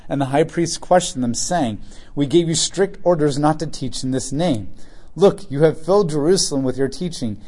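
scripture reading. Acted. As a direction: read the passage out to the church. Reads scripture aloud to congregation.

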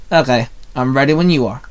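ordinary delivery, nothing marked

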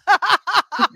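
laughing at the eighties perm. Real, not acted. Friend laughs at the eighties perm, real burst of laughter.

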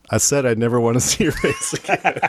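mock firing, cracking up midway; laughing retelling. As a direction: quote the angry boss but break into laughter. Retold firing line breaks into laughter.

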